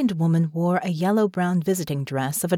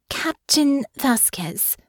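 formal greeting; 8 semitones higher, much slower than usual. Acted. Honor greets the captain formally.

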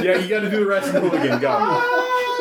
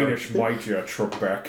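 Sean Connery impression; 9 semitones lower, 7 dB quieter than usual. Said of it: "Sean, Sean Connery".

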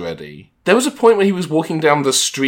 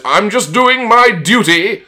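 put-on slow, louder, higher British-accented "duty"; British accent quote; 7 semitones higher, 5 dB louder, slower than usual. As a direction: deliver the poop emoji's line in a British accent, a touch hammed. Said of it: "his T is, is hard".

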